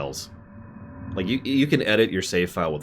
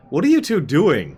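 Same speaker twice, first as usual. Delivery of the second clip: exasperated call; 11 semitones higher, 10 dB louder than usual. Real, not acted. Units wandering off in game.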